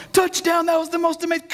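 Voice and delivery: very high touchdown call; excited sports commentator. Acted. Radio announcer calling a touchdown, excited commentator imitation. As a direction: imitate an announcer going wild.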